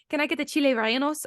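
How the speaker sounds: chili rellenos said "chee-lay rye-en-ohs", a Spanish attempt gone off; attempted Spanish pronunciation. Shown as a demonstration. Ordering at a restaurant with full accent, attempted Spanish pronunciation. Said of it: "which I just did really bad".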